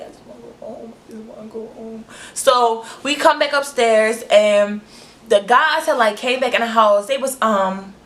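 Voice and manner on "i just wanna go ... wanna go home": tiny, barely audible murmur; very soft retold wish to escape; whispered plea. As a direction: whimper it privately to yourself, almost voiceless. Retold scared private thought.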